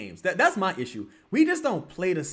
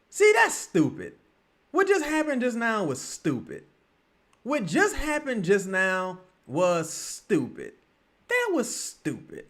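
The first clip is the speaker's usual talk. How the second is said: exasperated rant; 8 semitones higher, slower than usual. Real, not acted. Ranting at a botched play.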